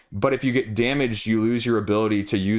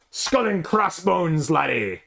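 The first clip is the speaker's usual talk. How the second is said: high, slow mock Irish pirate growl; 6 semitones higher, slower than usual. Showing off the pirate expansion car in a hammy Irish pirate voice. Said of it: "I don't know why I went Irish with the pirate".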